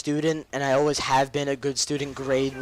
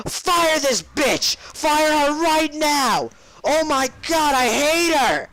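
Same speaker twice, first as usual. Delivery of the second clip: high, very drawn-out hammed-up yell; mock furious demand; 13 semitones higher, 5 dB louder, much slower than usual. Student bursting into the principal's office, mock furious shouting. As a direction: stretch every word into a comic tantrum.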